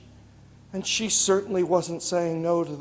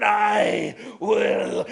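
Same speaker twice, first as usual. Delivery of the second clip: louder, eerie declaration; loud spirit vow; 5 dB louder than usual. Acted. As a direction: state it like an angry snow spirit. Snow woman answers the woodman.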